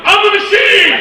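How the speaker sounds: high, roaring boast with a long last word; triumphant shout. Retold or imitated. Frat boy proclaiming himself to a Russian gangster, triumphant shout. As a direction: bellow it like a battle cry.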